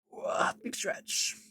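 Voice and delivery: breathy whispered stretch. Real, not acted.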